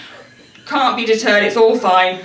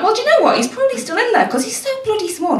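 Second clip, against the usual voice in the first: squeaky, high panicked self-talk; high-pitched frantic reassurance; 13 semitones higher, faster than usual. Retold or imitated. Retold panicked inner voice.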